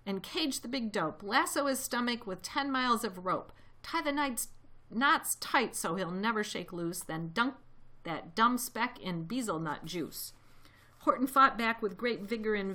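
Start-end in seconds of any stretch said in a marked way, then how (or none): none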